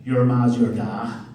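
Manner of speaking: lightly voiced Northern Irish wee insult, slow; a child mocking her dad's insult; kid imitating dad. Daughter mimics dad's insult in a mild Northern Irish voice. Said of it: "I'm gonna do an impersonation of my dad".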